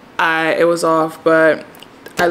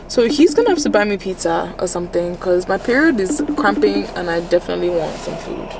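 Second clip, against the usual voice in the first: ordinary talk, nothing marked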